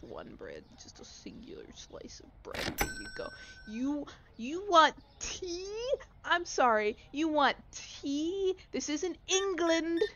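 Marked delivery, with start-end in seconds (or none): 3.7-10.0 slow, louder scoffing disbelief; slow mocking disbelief, louder; incredulous mockery. Real, not acted. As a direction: scoff at the tea order. Mocking a customer's tea order.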